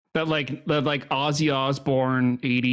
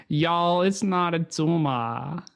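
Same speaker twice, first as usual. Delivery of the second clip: higher, slow Southern drawl on the tumor line; Southern Arnold; 4 semitones higher, slower than usual. Shown as a demonstration. Coaching a friend to do Southern Arnold. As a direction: drawl y'all first then Arnold. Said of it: "start it off by saying".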